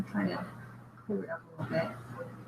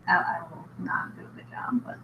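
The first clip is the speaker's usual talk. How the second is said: whisper; 3 semitones lower, 8 dB quieter than usual. Real, not acted. Aside about a bad British accent.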